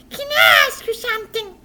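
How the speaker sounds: put-on high puppet boy asking; eager little question. Puppet boy asks his teacher, high.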